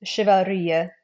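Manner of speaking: sh at the start, not ch; French-style chivalry. Shown as a demonstration. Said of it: "if you're saying chivalry".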